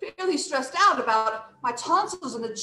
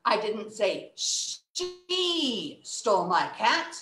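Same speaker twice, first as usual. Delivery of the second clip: SHE held very long; slow line with a drawn-out "she"; stress on SHE; much slower than usual. Shown as a demonstration. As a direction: put all the weight on "she". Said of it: "this really changes the meaning".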